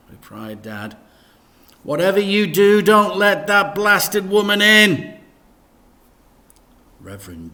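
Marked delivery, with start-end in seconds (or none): 1.8-5.2 loud, high exasperated dad; loud annoyed dad voice; grumpy dad. Acted. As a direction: grumble it loudly. Dad's voice in story.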